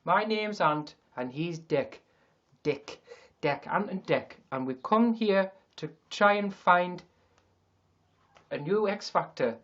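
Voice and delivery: Geordie impression drifting off target; slow, badly faked Geordie presenter; wobbly Newcastle accent. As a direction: attempt Newcastle, let it slide toward Indian. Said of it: "It'll turn into something else".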